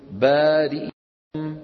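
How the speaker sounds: بارئكم with the kasra snatched, slow and drawn; بارئكم said slowly with a snatched kasra; ikhtilas demo. Shown as a demonstration. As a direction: say بارئكم slowly, kasra under the hamza barely voiced. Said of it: "نقول".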